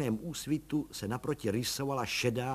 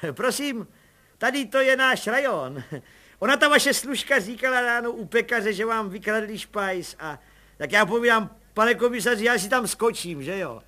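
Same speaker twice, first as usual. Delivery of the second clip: much higher chummy busybody voice; cheeky little policeman; 10 semitones higher, 8 dB louder than usual. Acted. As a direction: play the eager nosy constable. Pištora voice.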